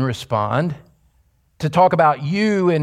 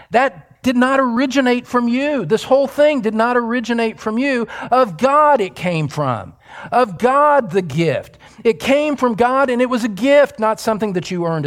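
high, insistent declaration; Paul; 9 semitones higher than usual. Acted. Preacher as apostle hammers the point to readers, insistent. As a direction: press on urgently through an unfinished sentence. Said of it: "This is Paul speaking".

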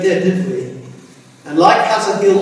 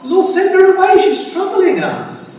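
disciples' complaint; 10 semitones higher than usual. Retold or imitated.

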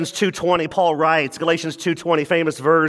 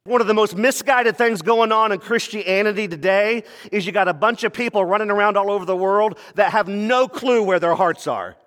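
normal talking voice

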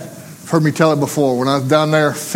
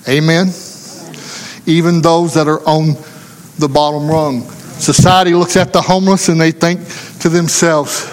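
ordinary delivery

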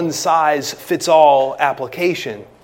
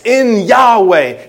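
"In Yahweh" slow, higher and louder, leaning on "In"; preacher driving home the emphatic position of "In Yahweh"; emphatic stress; 6 semitones higher, 9 dB louder, much slower than usual. Shown as a demonstration. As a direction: stretch out "In Yahweh" and punch "In".

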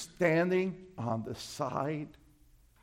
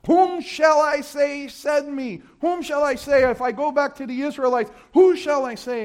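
pleading shout; 10 semitones higher, 7 dB louder than usual. Acted. Preacher voicing Moses, shouting his question to God.

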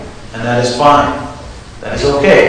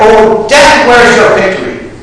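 raised, ringing victory call; exultant cry; 5 semitones higher than usual. Retold or imitated. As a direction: proclaim it like a shout of triumph. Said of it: "he can cry out".

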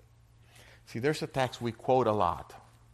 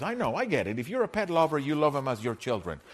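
usual speaking voice

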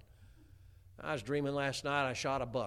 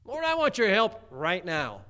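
loud, high, drawn-out mock plea; demanding prayer; 6 semitones higher, 10 dB louder than usual. People praying to the Lord in a hurry, demanding prayer. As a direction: demand help from God, slow and loud. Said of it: "That's kinda how we pray".

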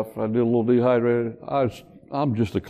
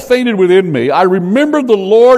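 raised, fervent reading of the psalm; scripture reading; 7 semitones higher, 8 dB louder than usual. Acted. Preacher reading Jonah's prayer to the congregation, raised and fervent. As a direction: read it out like a prayer from the pulpit.

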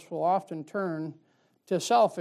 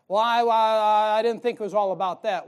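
mock protest; 3 semitones higher, 9 dB louder than usual. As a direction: throw the excuse back loudly. Mock excuse of runner.

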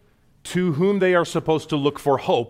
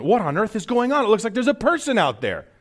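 high, rushed, alarmed voice; alarmed disciples; 10 semitones higher, faster than usual. Acted. Disciple spotting a figure on the water, acted alarm. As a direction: blurt it out in startled alarm. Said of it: "Imagine what they're saying to each other".

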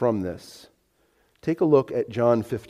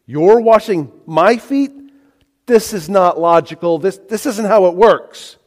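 higher, louder incredulous objection; emphatic disbelief; 5 semitones higher, 5 dB louder than usual. Acted. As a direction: protest it as Peter, baffled and insistent. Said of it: "Very emphatic the way he asks this question".

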